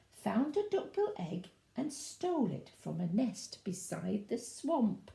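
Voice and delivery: usual speaking voice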